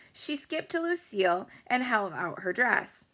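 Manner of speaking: plain reading voice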